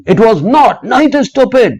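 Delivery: high, loud angry snap; angry shout. A narrator's reading. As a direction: shout it to defend his mother. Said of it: "पापा चिल्लाए बहुत जोर से". (बेटी पर ऊँची, तेज़ गुस्से वाली चीख़)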